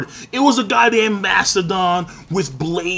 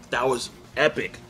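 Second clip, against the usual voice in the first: ordinary talk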